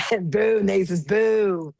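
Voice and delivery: drawn-out teasing boo; slow playful heckle; mock booing. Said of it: "That's what he normally does".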